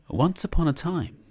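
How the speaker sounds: ordinary delivery, nothing marked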